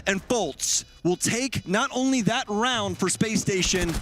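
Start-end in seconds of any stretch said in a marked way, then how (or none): 0.1-3.0 high, urgent shouting call; excited play-by-play. Real, not acted. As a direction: call the retake with rising hype. Live play-by-play of a bomb plant.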